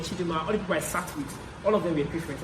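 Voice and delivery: usual speaking voice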